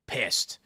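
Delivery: heavy irritated exhale through the nose; annoyed sigh. Retold or imitated. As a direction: sigh like a fed-up cabbie. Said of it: "And he went".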